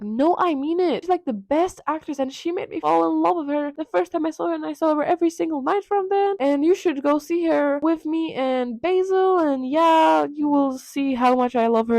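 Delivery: put-on high-pitched gushing young lover; lovestruck insistence. Dorian defending his love to Henry, high mock gushing. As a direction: gush on and on, playfully acted.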